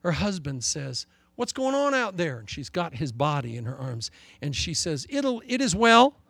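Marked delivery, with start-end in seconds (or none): 1.3-2.4 a high, lightly voiced husband calling. Husband calling to his wife from the house, lightly voiced. 5.1-6.2 high, slow, steady "it is well"; brave reassurance. Acted. Grieving mother assuring her husband all is well, held steady.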